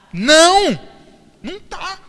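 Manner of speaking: loud, high exclaimed no; emphatic no. Real, not acted. Preacher answering his own question, loud and high. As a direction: burst out with the answer.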